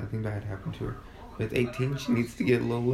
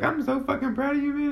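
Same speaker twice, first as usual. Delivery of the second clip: much higher, over-the-top gushing praise; gushing stoner. Retold or imitated. Telling her brother she's proud of him, gushing high. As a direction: gush at little brother while high. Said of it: "I was like".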